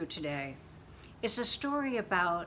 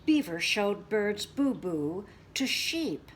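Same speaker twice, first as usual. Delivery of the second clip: plain reading voice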